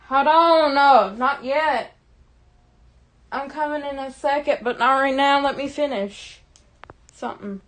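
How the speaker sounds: loud, high yell to someone off camera; calling out. Real, not acted. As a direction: holler to hold off an interruption, stretching the words. Calls off to someone interrupting.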